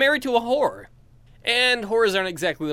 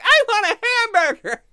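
put-on much higher, louder kid-like demand; childish high whine; 8 dB louder than usual. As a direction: whine like a child wanting a burger. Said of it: "and I'm like".

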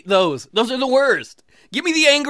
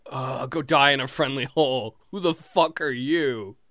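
tag; 7 semitones lower than usual. Acted. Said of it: "that's not my natural demeanor".

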